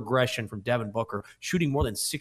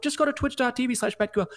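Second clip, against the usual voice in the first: fast plug; 11 semitones higher, faster than usual. Real, not acted. Quick promo plug.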